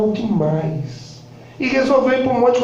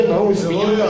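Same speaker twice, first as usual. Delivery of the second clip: ordinary talk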